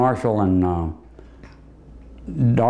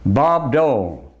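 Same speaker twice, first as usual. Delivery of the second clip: ordinary talk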